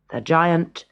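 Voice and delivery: plain reading voice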